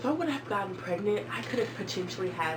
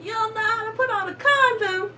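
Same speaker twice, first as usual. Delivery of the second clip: sassy teasing; 7 dB louder than usual. Retold or imitated. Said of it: "I was like".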